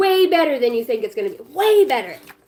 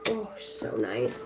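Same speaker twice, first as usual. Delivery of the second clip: soft sarcastic coo; 11 semitones lower, 9 dB quieter, much slower than usual. Real, not acted. Toddler smears chocolate on her.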